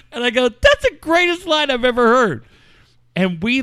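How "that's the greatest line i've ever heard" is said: much higher and louder, delighted disbelief. Retold or imitated. Praising a grieving friend's joke, high and delighted. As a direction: cheer your friend's line.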